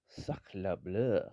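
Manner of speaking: hammed-up French accent, slow and drawn out; bad French accent. Commentating on the Frenchman's goal, bad French accent. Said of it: "that was a bad French accent".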